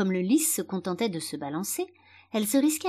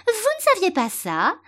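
high, loud, piercing little flower; shrill-voiced daisy crying out; daisy's cry; 12 semitones higher, 6 dB louder than usual. A narrator's reading. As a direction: shriek the question in surprise. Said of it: "cria une autre marguerite".

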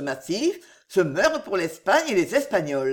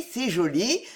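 ordinary delivery

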